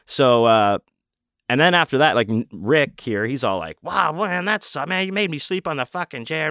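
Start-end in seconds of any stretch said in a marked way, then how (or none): 3.8-6.5 high-pitched imitation of a griping friend; mock complaining. Rick complains about sleeping in chair.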